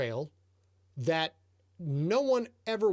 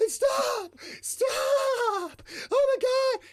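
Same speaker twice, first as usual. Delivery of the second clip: much higher, louder panicked yell; desperate shout; 4 dB louder, much slower than usual. Retold or imitated. Lost snowmobiler shouting at the local to stop. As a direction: scream at him to stop before he passes.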